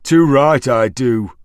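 slow, loud, clenched anger; through gritted teeth. A narrator's reading. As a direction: grind it out between teeth. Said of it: "through gritted teeth".

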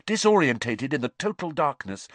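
ordinary delivery, nothing marked

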